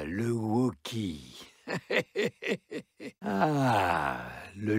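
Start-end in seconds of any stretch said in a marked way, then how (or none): 1.7-3.2 high wicked cackle; evil cackle. Acted. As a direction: cackle like a gloating villain. Villain laughs between lines.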